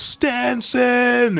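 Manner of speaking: high, drawn-out cry; yelled name. A narrator's reading. Hydruden finally yells his fallen friend's name, crying out. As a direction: yell the name long and high. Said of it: "and yells his name".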